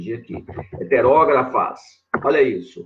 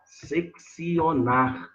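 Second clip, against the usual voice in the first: Seccionar drawn out slowly, sek-sio-nar; slow teacher dictation; 4 dB quieter, much slower than usual. Shown as a demonstration. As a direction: stretch Seccionar so students can write it. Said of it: "é do verbo seccionar".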